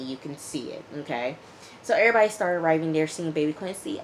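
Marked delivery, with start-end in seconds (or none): none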